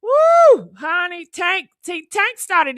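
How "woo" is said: high loud whoop; triumphant whoop. Real, not acted. Host hyped after her boast, high whoop. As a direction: let out a victory whoop.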